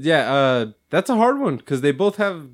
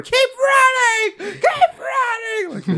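much higher, louder, slow drawn-out yell; psycho shout; 4 dB louder, much slower than usual. Retold or imitated. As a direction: scream it at him like a crazed woman with a bat. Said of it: "I kept rewinding just her".

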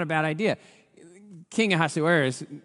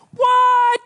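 shocked shout; 12 dB louder than usual. Real, not acted. Preacher exclaims at the twist.

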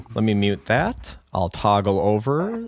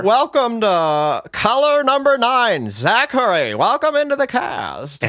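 cheesy host voice; 9 semitones higher than usual. Acted. Hamming up the host bit.

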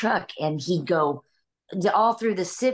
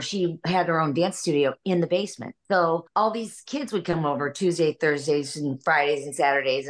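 ordinary talk, nothing marked

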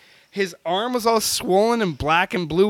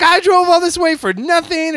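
higher, louder mock-angry complaint; retold angry rant; 10 semitones higher, 8 dB louder than usual. Host retelling Hang's angry complaint after the breakdown, mock-angry. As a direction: vent it like a fed-up friend. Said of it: "she was pissed".

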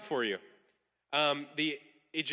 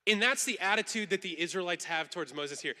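normal talking voice

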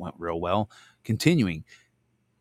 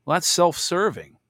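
louder, higher, pointed scoff; indignant; 8 semitones higher, 6 dB louder than usual. Real, not acted. Preacher judging the rich young man, sharp and loud. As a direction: call it out sharply.

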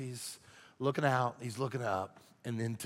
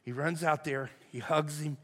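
ordinary delivery

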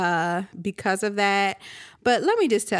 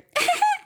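imitated yelping laugh. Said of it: "What is that? Is that a dog?".

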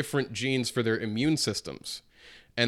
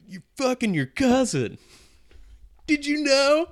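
high, teasing announcement; mock gossip announcement; 7 dB louder than usual. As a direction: break the news with glee.

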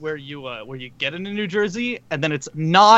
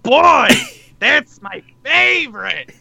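high, loud delighted shout; overjoyed outburst at the joke; excited shout; 10 semitones higher, 8 dB louder, slower than usual. Real, not acted. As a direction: yell it with glee. Delighted reaction.

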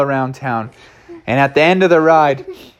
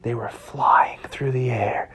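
slow hushed whisper; slow suspenseful whisper; 3 semitones lower, 7 dB quieter than usual. A narrator's reading. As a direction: breathe it in suspense.

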